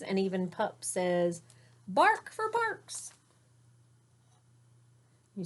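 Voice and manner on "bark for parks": very high, slow puppy voice. A narrator's reading. Pup the dog joins the chant in a high slow yip. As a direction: squeak it out like a little dog.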